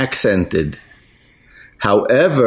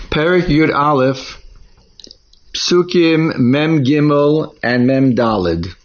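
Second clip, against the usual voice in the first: ordinary talk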